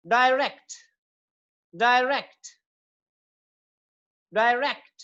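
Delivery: Direct with stress on "RECT"; second-syllable stress. Shown as a demonstration. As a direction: lean on "rect" in direct. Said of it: "second syllable पे होगी".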